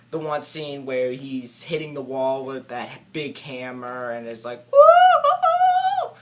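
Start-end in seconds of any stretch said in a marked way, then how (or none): 4.7-6.2 very high, loud, drawn-out whoop; wild mock whooping. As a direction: whoop like a manic cartoon character. Imitating wild scene with the hammer.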